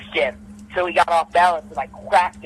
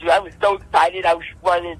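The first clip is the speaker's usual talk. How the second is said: ordinary talk, nothing marked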